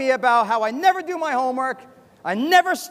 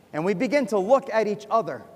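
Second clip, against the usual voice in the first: ordinary talk, nothing marked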